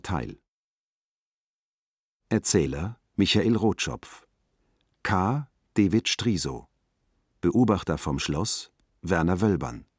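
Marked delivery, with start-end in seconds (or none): none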